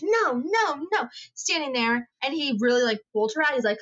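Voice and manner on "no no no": lightly voiced child refusing, a bit higher; whiny toddler no. Daughter refusing to leave the store, mild whiny no. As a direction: play the stubborn little girl, mildly. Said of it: "And she's".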